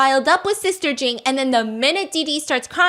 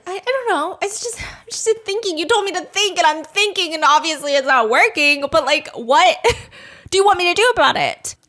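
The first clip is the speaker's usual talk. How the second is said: put-on flustered girl; 5 semitones higher, 4 dB louder than usual. Voicing flustered Didi.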